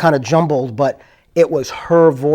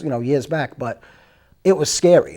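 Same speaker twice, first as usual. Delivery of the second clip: ordinary talk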